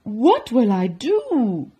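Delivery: distressed cry. Acted. Baker with his milk spilt, distressed cry. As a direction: cry out as if at a loss. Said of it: "cried Walter".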